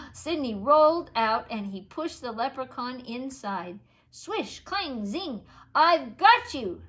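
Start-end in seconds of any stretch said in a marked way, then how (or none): 4.1-6.8 loud triumphant shout; yelled. A narrator's reading. Boy yelling at the trapped leprechaun. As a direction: yell it as he catches him. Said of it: "Sidney yelled".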